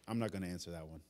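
low, soft, dry reply with a chuckle; amused God voice. Retold or imitated. God answering the emotional prayer, amused and quiet. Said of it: "And he goes".

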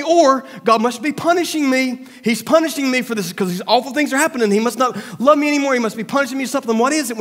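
hammed-up believer whining that God forgot them; mock complaining to God.

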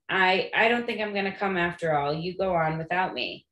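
ordinary delivery, nothing marked

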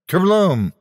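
high comic blast noise; explosion noise. Acted. Sound effect of the battle, comic blast. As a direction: splat it out like a cartoon explosion.

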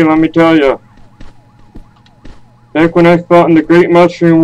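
lightly voiced confused grandpa; old man voice. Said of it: "the confused old man around the server".

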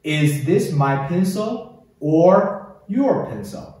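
slow model reading with long pauses; 领读示范. Shown as a demonstration. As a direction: read each sentence slowly and wait for the learner. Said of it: "我专门留出时间来给你读哦". (慢速领读，句间留时间跟读)

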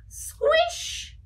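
high squeaky squish sound. Acted.

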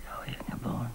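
barely voiced, near a whisper; hushed confession. Mother confessing to Selma, whispered. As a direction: breathe the line out in despair.

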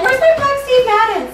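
mock panic. Friend acts out searching for her shoes in high mock panic. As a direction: pretend to be frantic over lost shoes. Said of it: "And I'm like".

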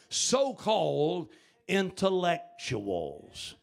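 SO-CALLED drawn out and set apart before INTELLECTUALS; emphatic. Real, not acted. Preacher on those who scorn religion, emphatic SO-CALLED. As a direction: slowly pick out "so-called ... intellectuals" with pauses. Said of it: "I say it with emphasis".

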